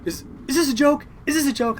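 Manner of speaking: high, loud incredulous question; retold disbelief. Uncle finding out about the dinner prank, incredulous. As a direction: ask as the stunned uncle.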